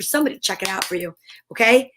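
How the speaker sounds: ordinary talk, nothing marked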